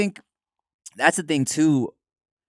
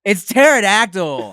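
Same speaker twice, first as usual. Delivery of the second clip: loud, slow, higher "It's pterodactyl."; stubborn kid digging in, slow and loud; insistent shout; 9 semitones higher, 8 dB louder, slower than usual. Retold or imitated. Kid loudly insisting to his classmate, slow and stubborn. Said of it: "but I'm like".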